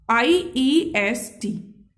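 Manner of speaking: a teacher spelling out the superlative ending; slow spelled-out letters. Shown as a demonstration. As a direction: stretch each letter slowly. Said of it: "क्या लगाएंगे".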